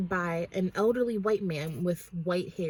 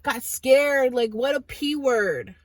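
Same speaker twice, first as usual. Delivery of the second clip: put-on higher, louder taunting kid voice; mocking teenagers; 5 semitones higher, 3 dB louder than usual. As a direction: jeer like a cocky teen.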